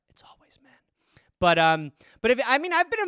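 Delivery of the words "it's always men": whispered aside. Real, not acted. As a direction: whisper it like a secret. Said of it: "not to stereotype".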